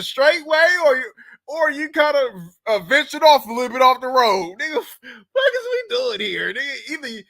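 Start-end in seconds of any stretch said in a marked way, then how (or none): 4.6-6.5 high, slow mock-anguished cry; exasperated wail. Real, not acted. Host lamenting modern labels, high slow mock wail. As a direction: wail it like it's all gone wrong.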